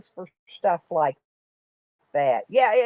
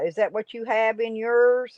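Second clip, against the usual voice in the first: usual speaking voice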